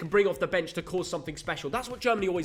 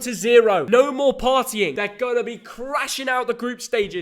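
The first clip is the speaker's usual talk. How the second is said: louder, higher taunting call; mocking shout; 5 semitones higher, 7 dB louder than usual. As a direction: jeer at France's downfall. Mocking France prediction.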